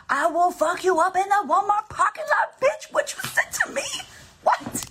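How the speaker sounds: very high-pitched aggressive alter ego threat; Juanita. Acted. Dark alter ego picking a fight in the Walmart parking lot, high aggressive threat. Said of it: "I still have Juanita inside of me".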